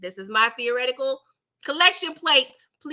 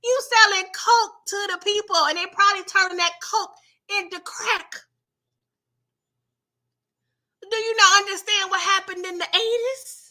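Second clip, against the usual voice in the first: sassy scolding woman; 8 semitones higher than usual. Retold or imitated. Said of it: "She was like".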